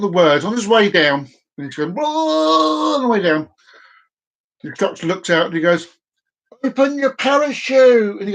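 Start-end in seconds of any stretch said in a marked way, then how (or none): 1.9-3.0 high, stretched-out falling wail; a hammed-up yell as he drops; cartoon falling cry, high and long; falling whoa. Man falling from plane. 6.6-8.2 high, slow shout from the plane door; calling down. Acted.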